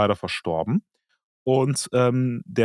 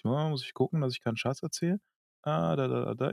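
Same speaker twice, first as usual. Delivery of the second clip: murmelnd, viel leiser, beim Nachschauen; leise vor sich hin; 2 semitones higher, 10 dB quieter, faster than usual. Real, not acted. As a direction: sprich es leise vor dich hin, während du suchst. (leise gemurmelt)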